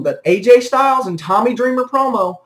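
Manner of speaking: usual speaking voice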